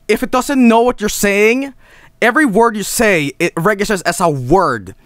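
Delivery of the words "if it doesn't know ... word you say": ordinary delivery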